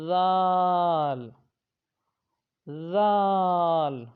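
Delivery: ذال long and loud with the tongue between the teeth; tongue-out letter. Shown as a demonstration. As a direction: stretch ذال slowly, tongue touching the upper teeth. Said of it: "جیسے آپ نے اوپر والا یہ حرف پڑھا تھا". (ذال زبان باہر نکال کر لمبا)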